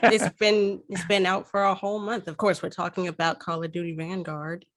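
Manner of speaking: ordinary talk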